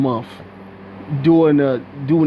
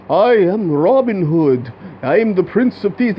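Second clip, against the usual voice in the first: mock British accent. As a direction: ham up a posh British hero. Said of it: "he start off with a British accent".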